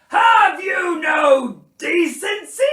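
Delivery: high, very loud, drawn-out outrage; bellowing. A narrator's reading. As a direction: bellow like a scandalised bear. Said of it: "bellowed Bear".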